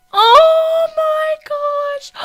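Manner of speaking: very high, slow squeal ending in a gasp; high stunned squeal; shocked squeal. Real, not acted.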